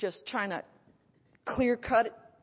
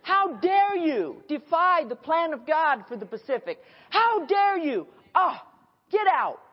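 loud, much higher furious shouting; furious rebuke; 8 semitones higher, 7 dB louder than usual. Retold or imitated. Preacher shouting the devil out of her mind, furious. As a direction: drive the devil out with fury. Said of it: "Where I was like".

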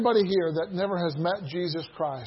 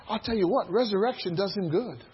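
ordinary delivery

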